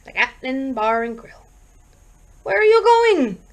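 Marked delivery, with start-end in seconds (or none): 2.4-3.4 higher, louder alarmed question. A narrator's reading. Vicky as husband opens the car door, alarmed. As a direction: call out in sudden alarm. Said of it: "Vicky asked with alarm".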